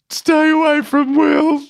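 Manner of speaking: high, slow tearful plea; Woody in tears. Retold or imitated. Colleague in tears begging the boss in the meeting, high tearful plea. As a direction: imitate a weeping man begging, drawn out high. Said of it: "Woody bursts into tears".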